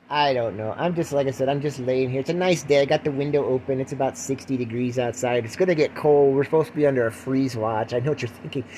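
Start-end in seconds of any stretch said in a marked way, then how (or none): none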